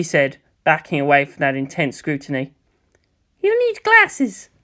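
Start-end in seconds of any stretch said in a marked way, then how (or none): 3.4-4.5 high, slow, deadpan tease; Jamyang teasing. Acted. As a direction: drag out the verdict slowly in a high voice.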